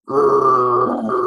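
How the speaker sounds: loud growl; angry dog growl. Shown as a demonstration. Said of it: "if the dog is angry".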